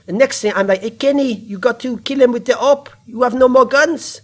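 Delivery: high, hammed-up French accent. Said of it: "[name], when you speak French".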